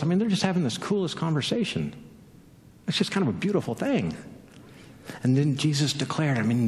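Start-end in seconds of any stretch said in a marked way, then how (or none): none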